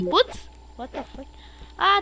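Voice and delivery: ordinary delivery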